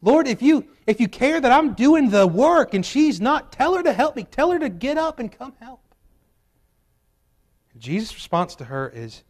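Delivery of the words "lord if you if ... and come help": much higher and louder, pleading demand; fed-up Martha. Retold or imitated. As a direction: demand it like an exasperated sister. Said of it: "Carroll County language".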